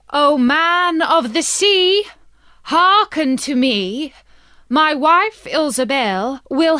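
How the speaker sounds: put-on old fisherman's sing-song summons; fisherman calling to the sea. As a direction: chant it out over the waves, pleading.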